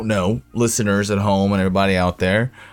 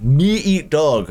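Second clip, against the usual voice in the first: slow, higher mock Russian accent in broken English; slow, pitched-up Russian accent parody; 5 semitones higher, much slower than usual. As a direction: ham up the bad Russian-accented subtitle. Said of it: "a little big Russian accent".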